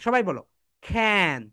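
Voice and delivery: ordinary talk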